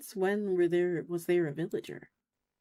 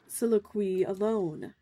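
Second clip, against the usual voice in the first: ordinary talk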